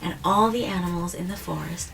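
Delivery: put-on gentle storybook reading to a child; story narration. A narrator's reading. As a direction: read it like a bedtime story, easing down at the end. Reading picture book to child.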